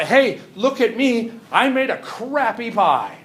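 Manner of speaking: slow, high, loud announcement; mock shout. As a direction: imitate a scientist proudly shouting out, word by word. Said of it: "Does the scientist shout out".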